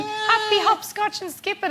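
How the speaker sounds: put-on high singsong child voice; playing children. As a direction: chirp it like kids in a playground. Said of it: "all the kids were like".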